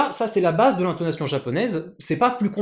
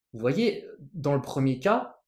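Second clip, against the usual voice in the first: usual speaking voice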